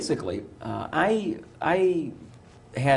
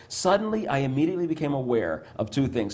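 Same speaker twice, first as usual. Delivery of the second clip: ordinary talk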